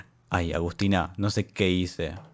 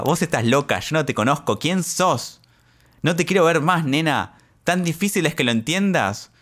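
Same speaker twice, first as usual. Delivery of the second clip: retold shouting; 6 semitones higher than usual. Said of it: "me gritaba".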